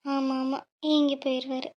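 low sarcastic grumble under the breath; muttered aside. Real, not acted. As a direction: mutter it to herself, sulking. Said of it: "என்னடி புலம்புற?". (தனக்குள் முணுமுணுப்பு)

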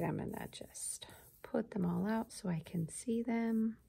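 soft unvoiced whisper to herself; whispered aside. Real, not acted. Teacher whispering to herself while sorting cards. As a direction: whisper it while laying them out.

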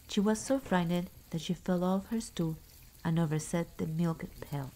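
ordinary delivery, nothing marked

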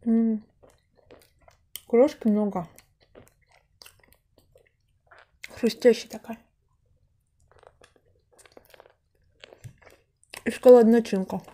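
talking between bites; mouth full, chewing. Real, not acted. Seen 1: Bit pastry at -5; cheeks full, chewing while speaking throughout.

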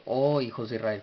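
weight on "Oh", falling away after; emphatic exclamation. Shown as a demonstration. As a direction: exclaim it, leaning hard on "Oh". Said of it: "una expresión de, de una énfasis".

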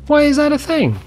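exasperated disbelief; incredulous. Real, not acted. Repeating question.

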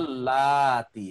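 আল্লাতি with the middle "লা" drawn out long; long vowel plural. Shown as a demonstration. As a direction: hold the "লা" in আল্লাতি, then finish on "তি". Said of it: "এই যে এটা বহুবচন". (আল্লাতি-র "লা" লম্বা টেনে বলা)

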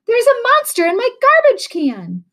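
put-on a frightened girl screaming for help; panicked scream. A narrator's reading. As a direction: shriek it down the phone in a panic. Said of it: "someone screamed".

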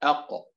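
a gentle bounce on the final ق of أق; أق with a light release; light qalqala. Shown as a demonstration. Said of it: "التمييز بين مراتب القلقلة".

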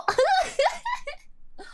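high-pitched burst of laughter; laughing. Real, not acted. Streamer cracking up at her accent, high laugh.

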